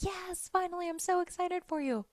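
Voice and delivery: high-pitched delighted cheer, retold; excited for a friend. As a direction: re-enact gleeful congratulations, voice way up. Re-enacts reaction to friend's news.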